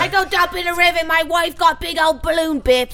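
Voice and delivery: quick, high comic Cockney; Cockney accent. Acted. Host showing off Cockney about the wife, hammed-up Cockney accent. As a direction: rattle it off in mock Cockney. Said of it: "Can you do Cockney?".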